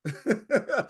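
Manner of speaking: quick amused laugh; a brief self-mocking laugh; short laugh. Real, not acted. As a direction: laugh at yourself. Laughing at own admission.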